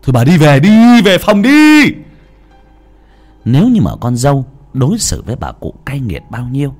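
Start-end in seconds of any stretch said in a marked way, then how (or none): 0.0-1.8 loud, much higher angry yelling; furious shout. Acted. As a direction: scream her out of the room. Son drives mother away.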